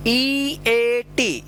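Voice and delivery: each letter held long; drawn-out, separate letters; slow spelling. Shown as a demonstration. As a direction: spell it very slowly for learners. Teacher spells out word for learners.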